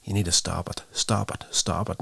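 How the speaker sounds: ordinary talk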